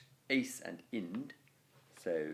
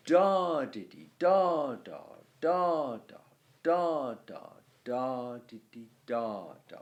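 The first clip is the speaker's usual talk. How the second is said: louder da on the first beat of each foot; stress on each foot; 2 semitones higher, 6 dB louder than usual. Shown as a demonstration. Teacher beating out the stress of each foot. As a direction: punch the first da of every foot. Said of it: "a little bit of stress on the first syllable".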